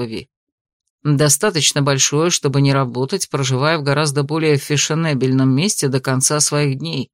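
lightly voiced man, low and even. A narrator's reading. Male character speaks, narrator voice.

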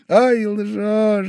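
high, knowing scoff; a smile that calls him a liar; mocking disbelief. A narrator's reading. Pavel Pavlovich silently mocking Velchaninov, high and knowing. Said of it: "говорила улыбка Павла Павловича".

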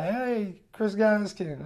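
high, drawn-out mocking hello; imitating a buddy ribbing him; teasing greeting. Fellow comic walking by starts ribbing him, teasing greeting. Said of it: "starts ribbing me".

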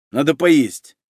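ordinary delivery, nothing marked

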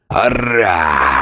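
a lightly voiced monster roar. A narrator's reading. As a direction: roar the word as a wounded beast. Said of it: "ревел он".

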